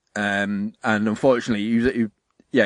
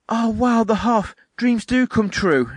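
high, slow, hammed-up wonder; awestruck sick child; 9 semitones higher, slower than usual. Sick kid seeing the Hoff at his bedside, mock awestruck. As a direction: play a dying kid's dreamy awe, mockingly.

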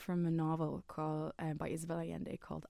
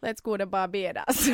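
hammed-up pop star line, not quite landing; 3 semitones higher, 8 dB louder than usual. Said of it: "bad impression of, um, [name]".

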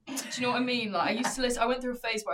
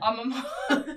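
giggling, higher-pitched line on demand; performing through laughter; 4 semitones higher than usual. Shown as a demonstration. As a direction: try to say it while cracking up. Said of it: "I'm like your performing monkey".

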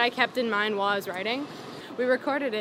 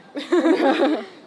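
laugh; 5 semitones higher, 10 dB louder than usual. Real, not acted. Embarrassed laugh after dog interruption.

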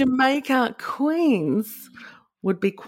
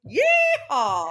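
cowboy cheer; 7 semitones higher than usual. Acted. Cheer after name chant.

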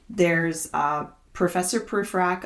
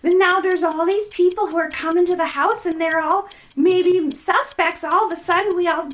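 excited high rush; 12 semitones higher, 3 dB louder than usual. Real, not acted. Frantic rushed book summary challenge.

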